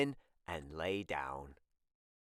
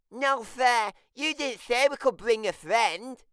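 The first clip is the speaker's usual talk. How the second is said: higher, louder little-brother voice; Tim's complaint; 12 semitones higher, 6 dB louder than usual. Acted. Tim complaining he was not told, higher and louder. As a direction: whine it like a left-out kid.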